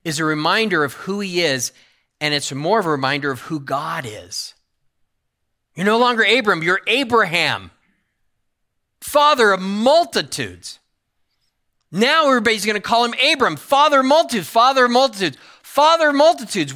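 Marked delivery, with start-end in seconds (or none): 5.7-16.7 higher and louder with each father of multitudes; full-force preacher's crescendo; preaching climax. Real, not acted. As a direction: build the repeated phrase to a ringing peak. Preaching climax, rising repetition.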